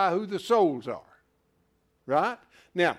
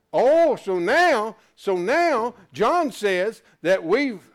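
loud, high, slow preacher's build; emphatic preaching; 4 semitones higher, 9 dB louder, slower than usual. Acted. Preacher leading the class back to heaven, in loud emphatic preaching. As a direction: lean hard on each phrase as you press the point.

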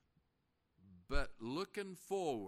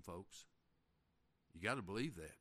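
ordinary talk, nothing marked

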